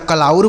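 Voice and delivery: कलाउर with an "au" diphthong in the second syllable; wrong pronunciation. Shown as a demonstration. Teacher warns learners off a misreading, कलाउर with "au". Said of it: "इसे ना तो कलाउर पढ़ेंगे".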